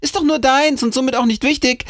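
hoch und vergnügt abgetan; fröhlich lächelnd. A narrator's reading. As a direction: mit hörbarem Lächeln den Bruder abfertigen. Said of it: "mit einem fröhlichen Lächeln". (fröhliches Lächeln in hoher Stimme)

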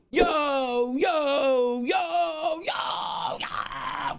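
high, loud drawn-out yells falling away at the end; hyped-up yelling. Pretend. Streamer opening the watch-along for viewers, hyped-up yelling. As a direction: open with a string of long hyped shouts.

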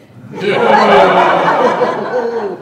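a very loud wicked cackle; evil cackle. Acted. Widow cackling over her poisoned attorney husband. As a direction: cackle at the arsenic punchline. Laugh after arsenic reveal.

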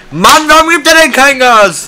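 high, loud exasperated outburst; frustrated shout. Real, not acted. Stuck behind a slow car. (genervt und laut)

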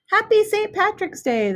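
calling out. A narrator's reading. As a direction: call the greeting out across the parade. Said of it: "they called out to everyone".

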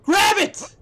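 high, loud panicked yell; urgent shout. Real, not acted. Gamer screaming at his character to grab on. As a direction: scream the command at the screen.

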